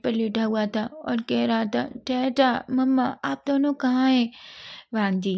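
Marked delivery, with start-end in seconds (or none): 2.1-4.3 put-on child calling out. A narrator's reading.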